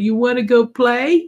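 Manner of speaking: imitating a child in a high voice; high-pitched kid asking a playmate; little girl. Granddaughter invites her brother to play, imitated little girl. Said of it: "She said".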